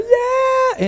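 high, whooping roller-coaster yell; thrill scream. Retold or imitated. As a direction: let it fly at the top of the drop. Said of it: "you are screaming and going".

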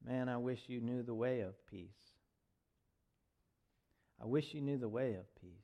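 soft, low lament; understated sorrow. Acted. Jesus crying as he looks over Jerusalem, soft lament. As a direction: say it low and grieving, without sobbing. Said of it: "And then he cries as he looks out".

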